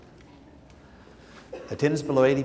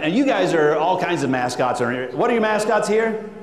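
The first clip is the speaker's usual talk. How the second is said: normal talking voice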